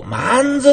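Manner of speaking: 「まんず」 with an added n before ず, Fukushima style. Shown as a demonstration. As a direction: say 「まんず」, not まず. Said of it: "より福島的にひねって言ってみる". (福島訛りで「まんず」と発音)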